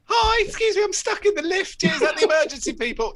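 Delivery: very high, loud squeaky voice; a flustered person stuck in a lift; squeaky caller. Pretend. Trapped lift passenger calling emergency line, very high and loud.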